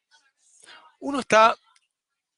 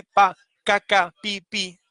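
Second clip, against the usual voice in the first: slow, higher pa caca pi pi like hammer blows; mimicking hammer strikes with clipped syllables; hammer imitation; 4 semitones higher, slower than usual. Shown as a demonstration. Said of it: "el sonido de martillar".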